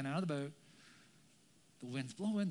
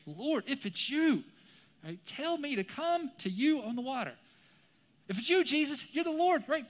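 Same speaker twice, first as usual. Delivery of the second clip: high, raised, eager plea; adrenaline-high eagerness; 8 semitones higher, 6 dB louder than usual. Acted. Peter asks Jesus to let him come out, excited. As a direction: call out keenly, carried away. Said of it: "he's on the high of adrenaline".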